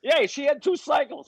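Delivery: high, slow mock aunt voice; hammed-up aunt, high and slow; aunt's excuse. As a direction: pitch it way up and drag it out. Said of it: "it's like at twelve".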